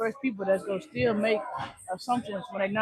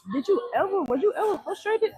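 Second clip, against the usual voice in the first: raised question; 10 semitones higher than usual. Retold or imitated. Asking his father a question.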